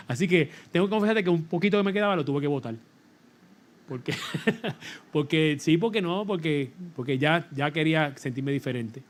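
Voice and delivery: normal talking voice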